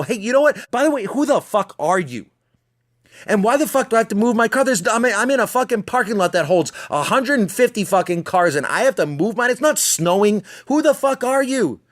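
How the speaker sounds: imagined angry rant. Pretend. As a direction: let loose on the guy, voice climbing. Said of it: "instinct at this point now is to roll down your window and go".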